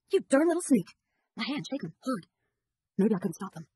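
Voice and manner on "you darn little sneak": angry scolding. Acted. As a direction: snap at the boy who sneaked after her. Sister catches brother sneaking in barn.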